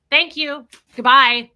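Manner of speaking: loud, clipped thank you then goodbye; curt rude brush-off. Retold or imitated. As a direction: dismiss her rudely with a loud goodbye. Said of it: "I was so rude to her".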